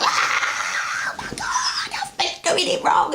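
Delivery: very high, breathy mock shriek; hammed-up squeal of realisation; imitating shrieks. Dream crowd realising their mistake, mock high breathy shriek. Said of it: "And they're like".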